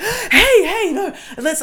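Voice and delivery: high, loud, flustered imitation of a date; urgent protest. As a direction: call out to stop someone leaving, hammed-up. Retold date urgently stopping her leaving.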